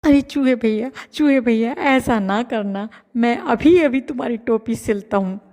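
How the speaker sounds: scared tailor begging the mouse; frightened. Acted. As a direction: plead fearfully. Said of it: "बोला चूहे से डरते हुए".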